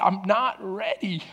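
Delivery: soft, choked-up retelling; barely able to talk. Retold or imitated. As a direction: say it quietly, as if holding back sobs. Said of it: "I can't hardly talk".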